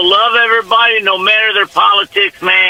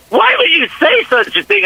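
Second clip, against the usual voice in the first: wife's protest, pitched much higher; mock scandalized; 8 semitones higher than usual. Caller retelling his wife's reaction to his plane joke, mock scandalized. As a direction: imitate an appalled wife in a raised pitch.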